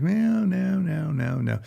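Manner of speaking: high, sing-along string of noes; mimicked denial. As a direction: imitate someone brushing off every question. Mimics client's repeated denial.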